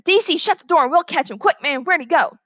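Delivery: high-pitched urgent schoolboy; eager helpful boy. Acted. Boy tells classmate to shut the door, urgent high voice.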